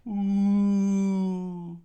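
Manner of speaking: high, loud, long ghostly ooh; eerie wind howl. Acted. The wind howling over the main street. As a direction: moan like the wind.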